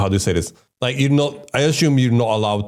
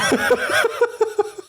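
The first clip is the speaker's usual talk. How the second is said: burst of laughter. Real, not acted. Startled by the animation.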